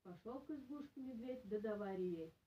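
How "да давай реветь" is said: usual speaking voice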